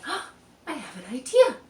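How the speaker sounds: light up with a sudden idea; a mother's eager discovery; excited idea. Acted.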